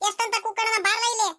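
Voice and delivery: high exasperated call to come here; impatient calling. Acted. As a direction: call him over, fed up with shouting. Mother calling son again, impatient.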